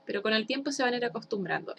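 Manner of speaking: ordinary talk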